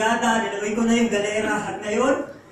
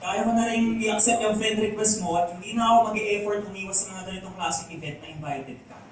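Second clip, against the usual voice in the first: normal talking voice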